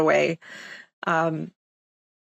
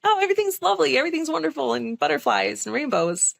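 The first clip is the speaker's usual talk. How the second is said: high, sing-song mock airhead voice; imitating her old bubbly sweet self; 8 semitones higher than usual. Mocking her former sweet self, high and sing-song. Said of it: "the bubbly, sweet one".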